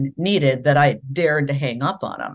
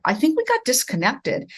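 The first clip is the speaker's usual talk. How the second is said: lightly voiced caller, a touch higher; patient caller trying again; polite caller; 9 semitones higher than usual. Boss calling back.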